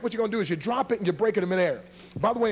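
ordinary talk

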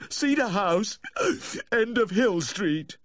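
high, slow crying voice; tearful pleading. A narrator's reading. Distraught owner giving the vet his address, tearfully. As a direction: force the address out through tears. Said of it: "pleading between sobs".

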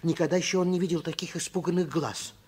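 normal talking voice

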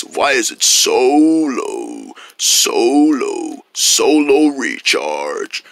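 deep low voice. Acted. Potion makes voice deep.

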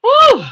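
high, loud "woo"; short high-pitched cry of "woo"; emotional whoop. Real, not acted. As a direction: let the feeling out in a whoop. Lets out emotion after tearing up.

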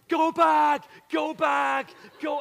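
high loud shout of go back; retold screaming. Teammates screaming at the new kid to go back, loud shout. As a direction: yell across the field at the runner. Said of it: "everybody's screaming at me".

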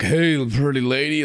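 put-on slow drunken come-on; mock drunk. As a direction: slur a pickup line, pretending to be drunk. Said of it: "you're drunk".

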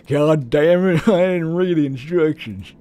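cuss in a big mock southern accent; exaggerated southern yokel accent. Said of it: "over the southern accent".

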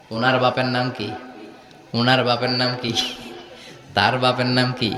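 low, level preaching chant; intoned sermon narration. Acted. Preacher retelling the Meccans' questions about God's ancestry, intoned sermon. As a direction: intone each question on the same low note.

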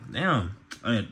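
ordinary delivery

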